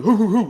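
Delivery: high, loud hooting with a laugh; laughing mockery of fake barking; mock dog hoots. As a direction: mimic a cop barking through his mouth.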